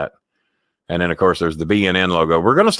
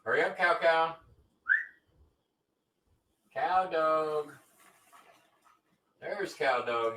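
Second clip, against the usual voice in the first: higher, coaxing pet voice; sing-song dog talk; 9 semitones higher, 10 dB quieter than usual. Real, not acted. Owner coaxing his dog to come along, sing-song pet voice.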